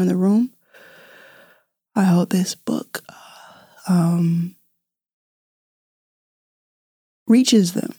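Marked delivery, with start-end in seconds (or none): 1.9-7.9 hushed, tearful whisper; holding back tears. Real, not acted. To her listeners, tearfully hoping the book reaches them. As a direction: voice catching on tears. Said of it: "I'm crying alone in my podcast room".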